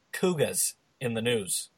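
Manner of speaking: put-on slow, soft announcement in Boston accent; bad Boston accent. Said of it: "bad Boston accents".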